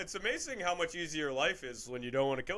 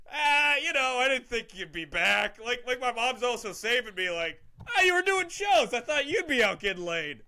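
hungover mom; 7 semitones higher than usual. Retold or imitated. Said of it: "just like".

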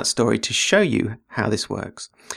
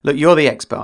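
ordinary talk, nothing marked

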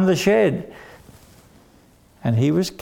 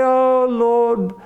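much higher, wailing "Oh Lord"; wailing preacher prayer; 9 semitones higher than usual. Acted. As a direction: ham up a crying preacher. Treasurer's hammed-up tearful prayer.

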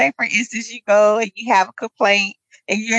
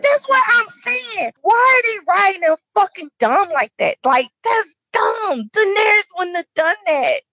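exasperated rant; 8 semitones higher than usual. Real, not acted. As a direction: yell it in disbelief at the dumb plan. Ranting about dumb battle plan.

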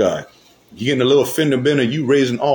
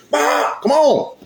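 mock frustrated honk; much slower than usual. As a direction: shout at the slow car ahead. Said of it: "You know, like that".

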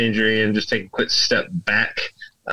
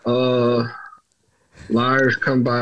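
ordinary talk, nothing marked

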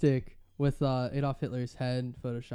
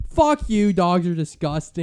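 loud, high angry retort; mock yell at a middle schooler; really loud; 4 semitones higher, 9 dB louder than usual. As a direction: throw it back at her full volume. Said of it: "He said "fuck" really loud".

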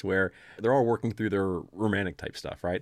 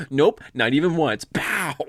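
louder mock smack-down; mock Hermione; 4 semitones higher, 7 dB louder, slower than usual. Hermione shuts Harry down, loud mock scolding. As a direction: shut him down with a pow. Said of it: "she's like".

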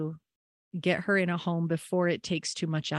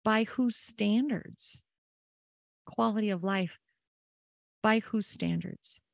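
slow, drawn-out, higher and pointed inner question; slow higher inner voice challenging the advice; 4 semitones higher than usual. Retold or imitated. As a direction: let the doubt stretch each word out slowly. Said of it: "my brain kept asking".

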